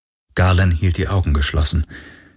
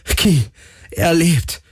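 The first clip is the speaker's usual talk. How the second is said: high, elated cry; jubilant; 10 semitones higher, slower than usual. A narrator's reading. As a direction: cheer it out to your companion. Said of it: "jubelte Galen". (jubelnd, in hoher Stimme)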